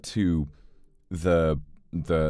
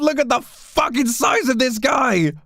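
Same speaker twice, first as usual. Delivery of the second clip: very high, slow, loud disbelieving shout; amazed shout; 6 dB louder, much slower than usual. Real, not acted. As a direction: scream in delighted shock. Amazed at huge dog.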